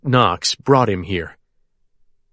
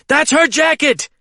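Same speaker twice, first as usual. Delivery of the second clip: high excited scream. A narrator's reading. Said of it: "I scream".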